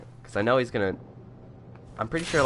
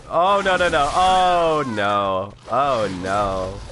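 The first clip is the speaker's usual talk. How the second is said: loud dismay; 6 dB louder, much slower than usual. Real, not acted. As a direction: cry out as it goes wrong. Enemies attack at once.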